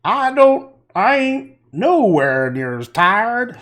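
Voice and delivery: high, loud, slow put-on Southern hillbilly twang; mock a politician faking a rural Southern accent; hammed-up Southern country accent; fake hillbilly accent. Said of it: "she faked the accent of wherever hillbilly country".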